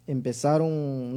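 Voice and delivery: ordinary talk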